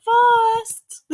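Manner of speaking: high squeaky imitation of Jenny; Jenny impression. Imitating Jenny's high voice to the viewers. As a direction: pitch way up to mimic a friend. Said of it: "That's my Jenny impression".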